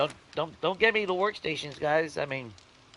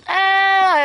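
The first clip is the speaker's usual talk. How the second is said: a loud, much higher happy squeal; joyful squeal; 10 dB louder than usual. Real, not acted. Gamer overjoyed the build needs no steel, squealing. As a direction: let out a delighted shriek.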